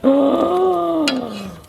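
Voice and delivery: sharp loud gasp of dismay; shocked gasp. Real, not acted. Finding the burnt pizza bottom, loud shocked gasp.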